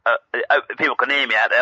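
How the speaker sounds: ordinary talk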